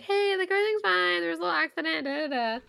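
high, sing-song fake-casual voice; mock breezy reassurance. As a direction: imitate someone brightly downplaying bad news. Imitating someone breaking scary news casually.

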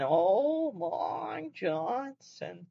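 drawn-out, very slow yowling "Oh, Long Johnson"; talking cat impression. Imitating the famous talking cat video, slow drawn-out yowl. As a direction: stretch each word like a meowing cat. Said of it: "the kid that was videotaped saying".

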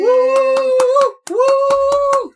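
high, loud whooping; cheering. Real, not acted. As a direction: cheer like a fan. Cheering the intro.

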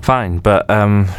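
ordinary talk

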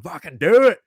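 hammed-up rowdy couch cheer; mock cheering on. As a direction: cheer the cops on like a rowdy fan. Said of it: "we're out here going".

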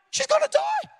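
very high-pitched alarmed squeal; hammed-up high panic; mock panic. His panicked reaction, retold.

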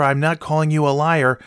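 usual speaking voice

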